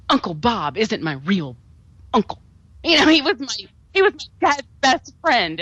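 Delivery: high, hammed-up melodramatic actress voice; overacted shocked revelation, pitched high; mocking dramatic reveal. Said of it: "where she's like".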